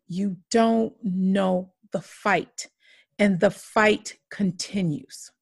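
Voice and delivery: ordinary talk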